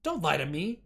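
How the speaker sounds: a stern woman yelling at the interviewee; furious. Acted. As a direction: belt it out at her. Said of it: "She belted out the words furiously".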